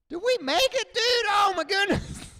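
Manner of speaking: very high, loud disbelieving shout; stunned shout. Retold or imitated. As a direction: shout in amazement at surviving. Pilot surviving crash, shouting.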